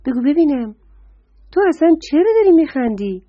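can't believe he's laughing; surprised deer. Acted. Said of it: "آهو خانم با تعجب پرسید".

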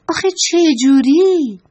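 a chorus of curious dolls crying out; puzzled dolls. Acted. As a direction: burst out in eager confusion. Dolls ask how, puzzled.

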